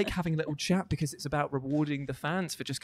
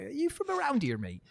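local bloke voice; 12 semitones higher than usual. Retold or imitated. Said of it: "he's like".

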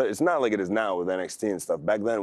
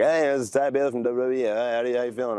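brighter, higher friendly phone caller; upbeat caller voice; 5 semitones higher, faster than usual. Retold or imitated. Retelling a call from WWE, cheery higher caller voice. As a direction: play the cheery WWE recruiter on the line. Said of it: "and he was like".

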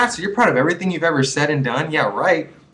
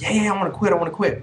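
imitating a friend, higher-pitched eager yes; quick retold eagerness, pitched up; eager agreement; 6 semitones higher, faster than usual. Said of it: "and he said".

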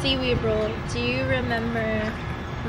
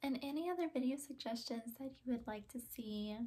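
soft vlog outro; 2 semitones lower, 21 dB quieter than usual. Real, not acted. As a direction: wind down gently to viewers.

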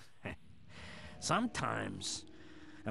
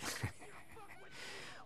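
wicked giggle; 10 dB quieter than usual. Real, not acted. Laugh at being shot.

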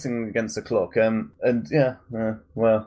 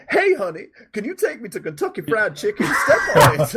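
high, hammed-up sassy passenger; strong, independent woman impression; 10 semitones higher, 3 dB louder than usual. Pregnant passenger ordering a taxi to the chicken shop, caricatured high voice. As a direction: pitch it way up, then punch the ending loud.

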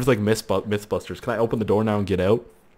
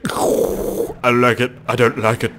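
slow, strained voice for a computer; complaining computer; 4 dB louder, slower than usual. Acted. Gamer voicing his struggling computer, slow complaining voice. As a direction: voice the machine grumbling in slow protest. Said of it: "My computer's like".

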